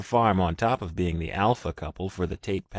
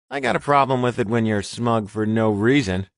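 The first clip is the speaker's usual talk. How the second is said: annoyed huff, a bit louder; 3 semitones higher, 6 dB louder than usual. Acted. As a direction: huff it out irritably. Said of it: "Richard huffed".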